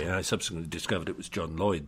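ordinary talk, nothing marked

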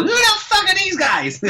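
very high, slow mock voice of a tough guy; mocking gym local. Locals eye the Irish boxers, high mock sneer. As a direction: ham up the local's disbelief. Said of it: "some fella goes".

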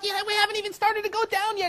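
high, fast and laughing; giddy teasing. Acted. Rider teasing screaming friends, giddy and fast. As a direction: tease them through the thrill.

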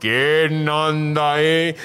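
slow, drawn-out spooky voice; ghostly call. Retold or imitated. Ghost in the graveyard calling out, slow spooky voice.

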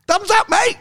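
high-pitched call of thumbs up; mock cheery mate. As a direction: put on a high, matey shout-back. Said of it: "And Tony's like".

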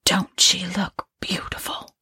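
tearful hush; a hushed, tearful whisper; grieving whisper. Acted. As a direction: whisper it over the bed. Woman over the body.

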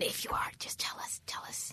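hushed, secretive whisper. Acted. Asking secret trailer makers to call.

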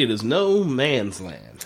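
"no" leaned on, slow and literal; stress on no. Shown as a demonstration. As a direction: spell out "no man's land" slowly. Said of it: "quite literally".

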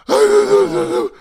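high, loud, slow, breathless wheeze; gasping panic. Retold or imitated. An exhausted runner gasping at the boarding gate, high and breathless. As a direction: squeak it out completely out of breath.

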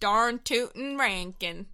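slow, drawn-out cowboy twang; cowboy accent. Shown as a demonstration. Demonstrating the requested voice to co-host, cowboy accent. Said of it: "cowboy voice".

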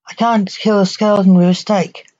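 ordinary delivery

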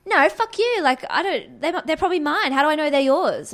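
retelling a drunk stranger's rude reply in a mock angry voice; mock drunk aggression. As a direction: play the rude stranger, hostile and loud. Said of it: "and she goes".

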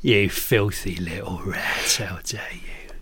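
slow, low, mock-venomous whisper; whispered insult. Cockney berates a cheat, slow whispered mock disgust.

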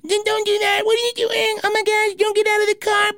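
very high, squeaky caricature of a wife; mock nagging wife. Wife pleading with driver to stay in car, mocking squeaky imitation. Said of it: "the whole time my wife's saying something like".

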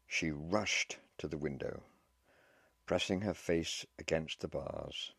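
ordinary delivery, nothing marked

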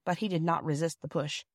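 ordinary delivery

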